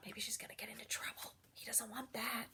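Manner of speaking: soft, quick whisper. Shown as a demonstration. Reader confiding to the children, whispering. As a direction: let the kids in on a secret. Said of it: "whispered aside to listeners".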